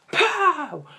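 loud, high imitated blast; explosive pow. Fan showing how the final speech hit, explosive pow. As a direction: burst it out like an explosion.

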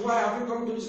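high-pitched mock protest; retold shouting. Madman confronting Jesus on meeting him, retold shout. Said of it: "he was shouting".

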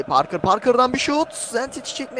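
sudden rising excitement as the shot flies; excited shot call. Real, not acted. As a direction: voice leaps high and loud on the shot. Shot on goal in live commentary. (heyecanlı şut anlatımı)

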